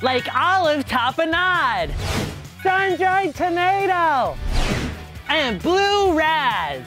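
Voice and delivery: drawn-out, slow flavor list; slow savoring. Acted. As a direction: linger on each flavor. Kid lists ad flavors slowly.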